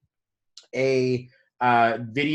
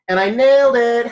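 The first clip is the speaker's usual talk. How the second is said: high, loud self-congratulation; triumphant; 8 semitones higher, 7 dB louder than usual. Retold or imitated. Man proud he didn't freak out over the crash, triumphant. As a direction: crow it like a big win.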